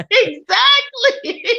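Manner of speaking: high, loud, drawn-out laughing shout; laughing agreement. Real, not acted. Guest agreeing with host's impression, real laughing outburst. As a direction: crack up while agreeing wholeheartedly.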